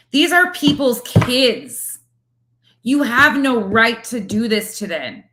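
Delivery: high, loud outraged tirade; furious. Real, not acted. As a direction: tear into her in fury. Furious rant at the creator.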